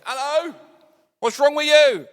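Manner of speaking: very high, loud, tactless holler; mock shout. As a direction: shout it at them, absurdly high and stretched. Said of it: "just shout at them".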